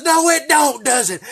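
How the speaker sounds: put-on high, loud furious bark at a kid; screaming state trooper; angry trooper yell. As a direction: blow up at the boy like an outraged trooper. Trooper yelling after bribe.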